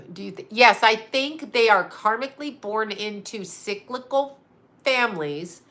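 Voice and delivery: ordinary talk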